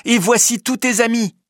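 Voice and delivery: high, excited announcement; exclaimed cry. A narrator's reading. Penellan presents the friends to Pierre, excited exclamation. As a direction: exclaim it joyfully on a high pitch. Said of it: "s'écrie Penellan".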